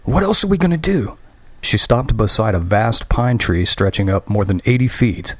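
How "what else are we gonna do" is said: desperate outburst. Acted. Desperate reply.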